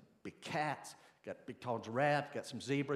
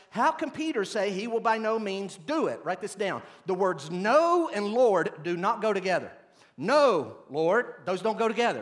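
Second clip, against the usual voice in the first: preaching at full force; 8 semitones higher, 10 dB louder than usual. Acted. Pastor challenging his congregation about obeying God, preaching hard. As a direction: hammer home that no and Lord don't go together.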